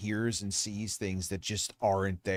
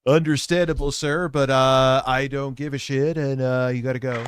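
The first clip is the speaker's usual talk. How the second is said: mocking the guard, much louder and higher; loud mocking imitation; 4 semitones higher, 10 dB louder than usual. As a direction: throw his words back at him, loud. Mocking the guard's words loudly.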